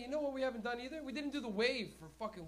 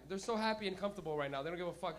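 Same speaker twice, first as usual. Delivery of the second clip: ordinary talk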